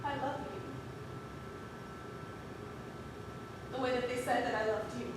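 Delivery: very soft, halting and low; quiet, troubled admission; hushed confession. Acted. Halting confession in a drama scene.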